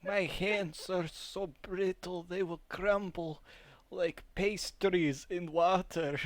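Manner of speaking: put-on old-country accent. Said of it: "your normal voice".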